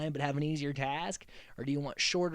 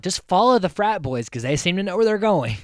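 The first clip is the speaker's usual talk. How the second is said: louder, higher, gleeful; loud excited mock quote; 5 semitones higher, 8 dB louder than usual. Dave and Margaret chasing the frat boys, loud and eager. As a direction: pitch it up and push it out eagerly. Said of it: "Dave and Margaret are like".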